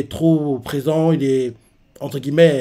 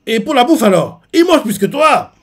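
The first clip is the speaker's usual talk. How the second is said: much higher and louder mock outrage; indignant Sandy; 9 semitones higher, 6 dB louder than usual. Sanji protests to Luffy about food, high indignant yelp. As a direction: squawk it like an offended cook. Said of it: "Sandy, pas content".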